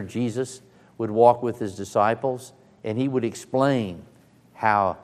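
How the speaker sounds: normal talking voice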